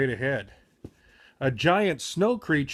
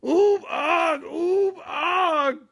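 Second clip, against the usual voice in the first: high, loud cartoon moaning; hammed-up terrified moans; 13 semitones higher, much slower than usual. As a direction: moan and wail in fright. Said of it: "their loud moans".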